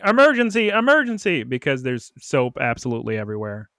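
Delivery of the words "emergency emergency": mock yell. Rotom warns of soap everywhere, mock yelling. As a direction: imitate a yelled alert, pitched up. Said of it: "Rotom starts yelling".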